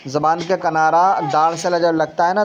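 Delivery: normal talking voice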